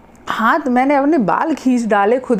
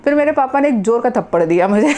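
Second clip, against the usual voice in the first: usual speaking voice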